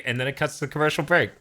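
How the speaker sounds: normal talking voice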